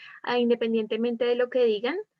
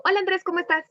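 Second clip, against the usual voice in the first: brisk, higher-pitched casual hello; serious, passing; 7 semitones higher, much faster than usual. Shown as a demonstration. Teacher contrasting two tones for students, serious and passing. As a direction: toss off a quick, businesslike greeting. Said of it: "se sabe que es algo más serio".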